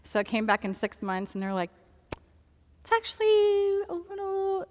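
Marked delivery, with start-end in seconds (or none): 2.8-4.7 imitating someone reluctant to break bad news; cagey hedging. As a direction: stall in a high voice, giving nothing away. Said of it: "they don't wanna give too much away".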